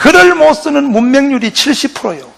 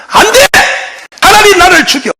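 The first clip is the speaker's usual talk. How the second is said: higher, forceful refusal; defiant outcry; 7 semitones higher than usual. Retold or imitated. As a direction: refuse with your life on the line. Rhee refusing American demand. (높게 외치는 결연한 거부)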